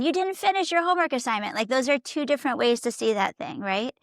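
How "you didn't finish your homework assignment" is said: higher, faster reproach over unfinished homework; critical nagging. Shown as a demonstration. Parent nagging child about homework, faster and higher. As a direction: scold the child for what's missing. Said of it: "rather than".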